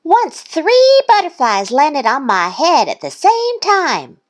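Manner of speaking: high, loud little-duck voice; Thistle's bragging voice. A narrator's reading. Thistle about to brag to Duck, high voice. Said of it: "Thistle said".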